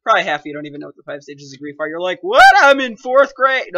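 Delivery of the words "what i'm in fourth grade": mock indignant kid with cracking voice; squawked protest, voice breaking. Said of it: "with that voice crack".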